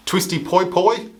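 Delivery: usual speaking voice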